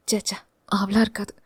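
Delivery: soft, quick dismissive muttering; muttered to herself. Acted. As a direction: brush the thought off under her breath. (தனக்குள் முணுமுணுப்பு)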